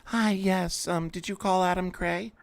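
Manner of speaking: sweet high receptionist voice; high put-on voice. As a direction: answer like a prim secretary. Host answers caller in put-on voice.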